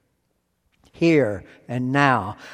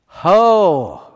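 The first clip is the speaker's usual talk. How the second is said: emphatic call; 2 semitones higher, 8 dB louder than usual. Shown as a demonstration. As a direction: call out "Ho!" to grab the crowd's attention. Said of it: "ho is this emphatic prefix".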